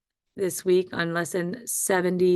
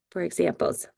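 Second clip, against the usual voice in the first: ordinary talk, nothing marked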